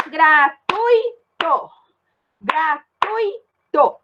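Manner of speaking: gratuito drawn out very slowly; slow demo. Shown as a demonstration. Teacher slowly showing gratuito to the class. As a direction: stretch gratuito, ui kept together. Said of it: "Ó, o U e o I ficou junto".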